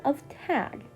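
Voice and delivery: put-on high, lively young teacher; bouncy and teasing, up high; playful teacher voice. Viridian explains game.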